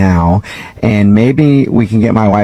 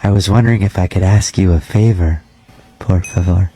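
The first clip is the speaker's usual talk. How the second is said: measured, slightly lowered janitor voice; lightly voiced creepy janitor; 4 semitones lower than usual. Night janitor cold-calling a gym member, lightly creepy voice. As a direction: play a polite, oddly intimate night janitor, understated.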